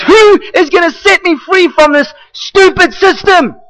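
high, strained cry of frustration; exasperated Paul. Retold or imitated. Preacher voicing Paul, a frustrated high cry. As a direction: throw up your hands and shout it. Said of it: "he goes".

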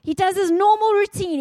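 ordinary talk, nothing marked

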